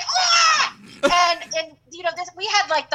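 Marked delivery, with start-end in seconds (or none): 0.0-0.7 high yelp of pain; pained scream. Retold or imitated. Boy stabbed with pencils, screaming high. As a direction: shriek like you've been stabbed. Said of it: "he's like".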